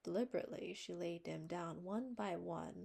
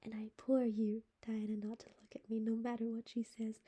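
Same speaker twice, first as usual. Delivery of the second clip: urgent whispered plea; 3 semitones higher, 6 dB louder than usual. A narrator's reading. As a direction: beg her friend under your breath. Anne whispering to Diana.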